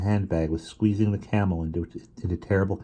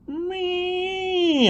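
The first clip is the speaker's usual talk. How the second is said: very high, drawn-out cat meow; a scruffy cat yowling from the basket; cat screech. A narrator's reading. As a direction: screech it like a tomcat.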